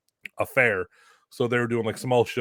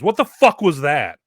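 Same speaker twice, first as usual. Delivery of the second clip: angry boss; 5 semitones higher, 9 dB louder than usual. Retold or imitated. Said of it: "promoter just looks at me and goes".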